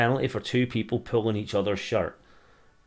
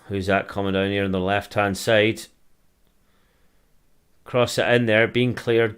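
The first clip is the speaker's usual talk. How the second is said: fan calling the match as it happens, a touch louder on the action; live play-by-play; 5 dB louder than usual. Acted. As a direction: call the attack like a pundit on the telly.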